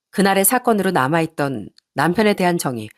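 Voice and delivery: plain reading voice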